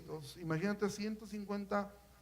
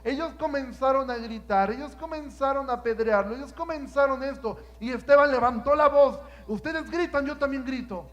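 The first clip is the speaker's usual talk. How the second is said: loud, rising preacher's cry; preaching at full force; 3 semitones higher, 10 dB louder than usual. Acted. Preacher shouting Stephen's story to the congregation. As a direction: build the sermon to a shout.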